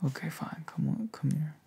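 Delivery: ordinary talk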